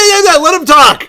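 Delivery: high, loud, urgent cutting in; urgent interjection. Acted. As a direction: push over the others at a high pitch. Cutting through the argument.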